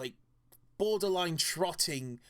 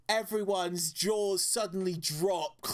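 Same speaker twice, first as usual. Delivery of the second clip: usual speaking voice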